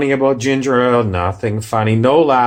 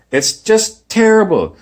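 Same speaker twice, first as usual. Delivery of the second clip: higher and louder, fed up; exasperated; 10 semitones higher, 5 dB louder than usual. Real, not acted. Producer pans the failed character, loud and fed up. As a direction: throw up your hands over it.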